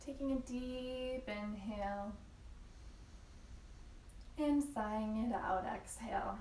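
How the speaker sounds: soft, unhurried yoga teacher voice with long pauses; calm, measured breathing cues; guided yoga meditation. Real, not acted. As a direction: guide them gently into stillness, leaving room to breathe. Leads a yin yoga practice.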